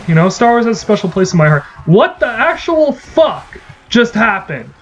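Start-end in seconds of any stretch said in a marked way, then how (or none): 1.8-4.7 high, slow, disbelieving rant; real gamer fury at an unfair death; outraged. Real, not acted. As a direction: stretch out the outrage. Unfair down in game.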